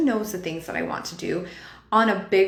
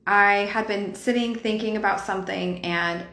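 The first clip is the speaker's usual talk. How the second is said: ordinary delivery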